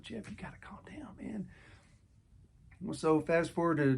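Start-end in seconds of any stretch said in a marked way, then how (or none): 0.0-1.5 whispered self-talk. Retold or imitated. Telling himself to calm down.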